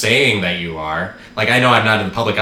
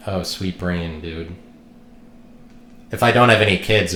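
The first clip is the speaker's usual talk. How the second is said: ordinary delivery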